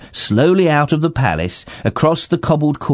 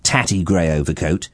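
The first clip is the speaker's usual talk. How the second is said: ordinary delivery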